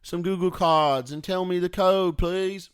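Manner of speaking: put-on British accent. British phone caller asking for gift card codes, mock British accent. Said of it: "Is it a British person going".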